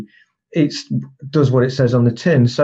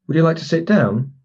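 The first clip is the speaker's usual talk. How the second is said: "sit down" pitched up as a question; higher, questioning tune towards "down"; questioning rise; 4 semitones higher than usual. Shown as a demonstration. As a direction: ask it, lifting the pitch on "sit down". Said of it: "if the phrase goes up at the end".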